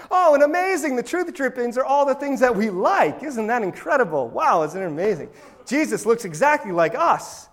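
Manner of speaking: high, gushing mock delight; mock amazement. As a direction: gush with exaggerated, sarcastic wonder. Mocks people delighted with their filtered truth.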